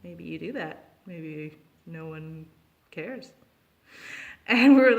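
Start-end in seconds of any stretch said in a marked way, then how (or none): 0.0-3.3 softer, knowing hint; sly hint. Retold or imitated. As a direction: drop it quietly like a wink. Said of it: "he was like".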